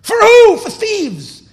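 high, loud indignant cry; loud incredulous outburst; indignant shout. Acted. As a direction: shout the question in outrage. Inner voice mocking his sacrifice.

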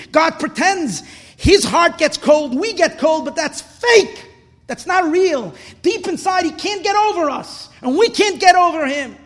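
high, loud, fervent sermon; impassioned preaching. Real, not acted. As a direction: preach it with urgent conviction. Preaches at full force.

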